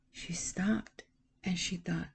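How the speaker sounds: hushed storyteller whisper. A narrator's reading. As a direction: breathe it out slowly, as a secret. Narrator pauses for suspense.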